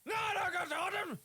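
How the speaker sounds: high-pitched frantic shouting; panicked yelling. Real, not acted. Player yelling at the game enemy, high panicked shouts. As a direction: yell in real panic at the enemy.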